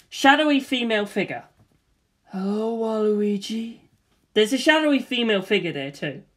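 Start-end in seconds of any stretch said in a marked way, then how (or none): 2.3-3.8 a ghostly lure, drawn out slowly; dark whisper behind you. Acted. Shadowy female figure in alley.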